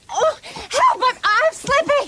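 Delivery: high, panicky cry for help; alarmed. Acted. Woman slipping as the camel stands, alarmed. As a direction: yelp it as if losing grip.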